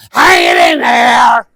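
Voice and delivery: slow, loud, drawn-out mock sigh; glum coworker. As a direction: play a worn-out colleague. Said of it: "you say".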